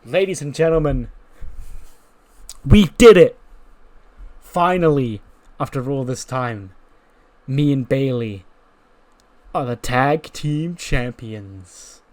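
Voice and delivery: big shout on we did it, then slow and drawn out; ring announcement. Acted. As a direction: announce it to the crowd like a champion. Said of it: "Cora's like".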